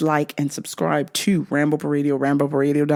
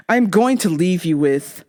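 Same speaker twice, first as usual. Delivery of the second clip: normal talking voice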